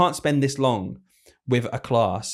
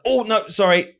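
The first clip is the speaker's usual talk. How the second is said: higher-pitched, flustered owning up; retold hasty apology; 9 semitones higher, 4 dB louder than usual. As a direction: jump in apologetically, re-enacting the moment. Owning up to the head teacher.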